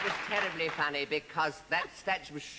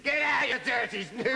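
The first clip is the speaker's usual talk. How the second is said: high, loud Cockney cry of get out; Cockney shriek; 12 semitones higher, 4 dB louder than usual. Retold or imitated. Actress retelling her Cockney shower line, high and loud. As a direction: yell it like a startled Cockney girl. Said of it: "you said".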